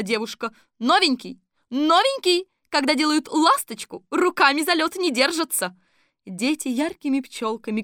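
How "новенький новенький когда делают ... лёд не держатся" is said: high, loud scolding coach. A narrator's reading. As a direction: scold the newcomer loudly.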